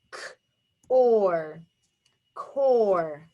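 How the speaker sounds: "k", then "or", then blended "core"; segmented phonics demo of core; tapped out. Shown as a demonstration. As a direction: sound out K, OR separately, then join as CORE. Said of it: "tap it out".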